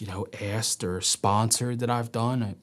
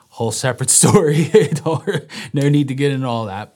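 laughing aside; 4 semitones higher, 10 dB louder than usual. Real, not acted. Laughing off a side story.

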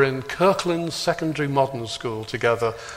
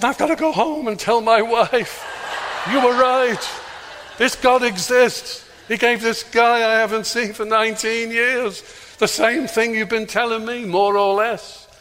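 excited milkman; 8 semitones higher than usual. Retold or imitated. Milkman telling the preacher his wife was right, excited and higher. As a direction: let the amazement spill out.

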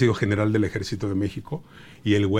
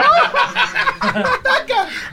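falsetto shriek laugh. Retold or imitated. Fleeing attackers in character.

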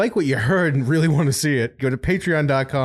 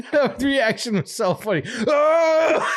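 laughing; 12 semitones higher, slower than usual. Real, not acted. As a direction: crack up at the reaction. Cracking up at the reaction.

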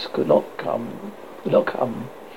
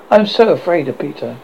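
ordinary delivery, nothing marked

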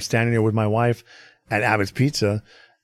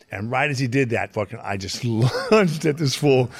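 ordinary talk, nothing marked

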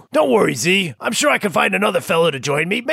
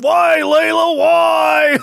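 anguished wail; 7 semitones higher, 4 dB louder, much slower than usual. Acted. Heartbroken wail.

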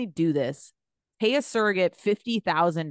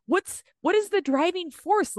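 incredulous; 12 semitones higher, slower than usual. Real, not acted. Disbelieving outburst.

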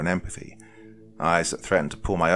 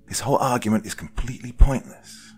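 higher, impatient cut-in; interrupting; 6 semitones higher, 4 dB louder than usual. A narrator's reading. Woman cutting short a pointless argument, impatient. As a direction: break in, exasperated. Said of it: "Allison interrupted".

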